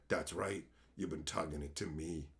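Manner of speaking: low soft creepy gloat. Pretend. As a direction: gloat in a deep quiet taunt.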